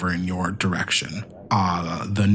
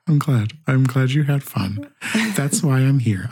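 lightly voiced robot, a bit higher; cheerful helper robot voice; 4 semitones higher than usual. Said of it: "I gotta stop doing my robot voice now".

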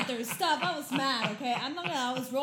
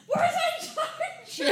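much higher, very slow, laughing yell; laughing mock shout; 5 dB louder, much slower than usual. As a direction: howl the question through laughter. Imitating the frantic friend.

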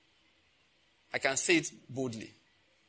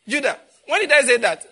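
loud, high-pitched protest in jest; mock indignation; 11 semitones higher, 12 dB louder than usual. Preacher calls on Judah over a misquote, loud mock indignation. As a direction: call out a playful, incredulous denial. Jokingly denying a misquote to congregation.

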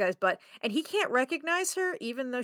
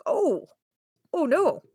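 mock dismay; 6 semitones higher, 4 dB louder, slower than usual. Retells man's dismayed reaction.

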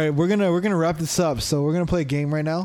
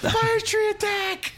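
high, drawn-out mock battle cry; Pokemon attack call; much slower than usual. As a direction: call out a cartoon creature's attack, laughing.

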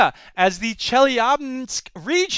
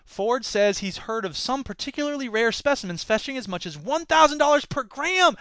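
usual speaking voice

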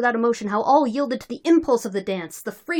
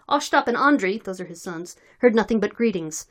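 ordinary delivery

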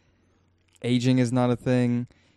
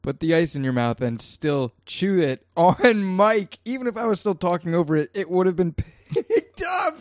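talking through laughter; breaking up with laughter; laughing; 8 semitones higher, 4 dB louder than usual. Real, not acted. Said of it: "I, I am laughing".

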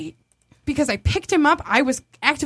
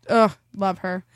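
usual speaking voice

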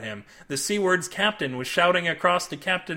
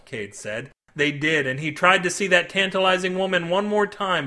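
usual speaking voice

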